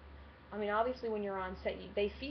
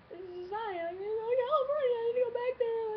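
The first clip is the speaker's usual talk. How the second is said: high, loud, whiny burst of longing; babyish excited outburst; 13 semitones higher, 6 dB louder than usual. Retold or imitated. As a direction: cry out like a kid who wants to go home. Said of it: "That's me being a little baby".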